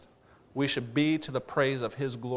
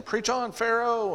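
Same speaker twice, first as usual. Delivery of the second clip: high, drawn-out heckling cry; visitor's call-out; 8 semitones higher, slower than usual. Retold or imitated. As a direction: imitate a back-row amen shouter, pitched way up. Visitor keeps calling to preacher.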